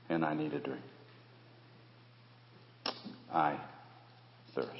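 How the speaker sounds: soft, parched, dying man; parched Jesus. Acted. Jesus on the cross asking for a drink, faint. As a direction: speak as if too dry to talk.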